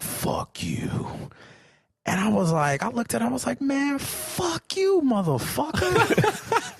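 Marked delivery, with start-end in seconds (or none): 0.0-0.9 low, hushed imitation of an angry cyclist; quiet low mock insult; muttered retold curse. Cyclist's curse acted in story. 3.6-6.7 high, drawn-out mock outrage; slow, high-pitched reenacted comeback; retold angry retort. Own road-rage retort acted out.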